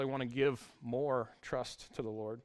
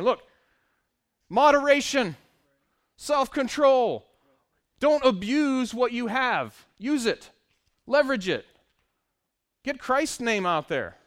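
loud, slow, high preacher's emphasis; preaching at full force; 8 semitones higher, 10 dB louder than usual. Acted. Preacher urging the church to self-control, preaching loudly. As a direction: hammer each word home from the pulpit. Preacher drives point home.

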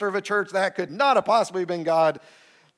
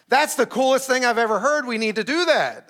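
higher, louder thrilled wife; excited wife; 8 semitones higher, 4 dB louder than usual. Retold or imitated. Wife delighted by the church plan, excited. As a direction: voice the wife bursting with enthusiasm.

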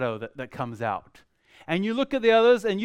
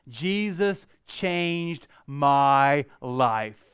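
slow statement; 4 semitones lower, much slower than usual. Shown as a demonstration. Opening statement read as text.

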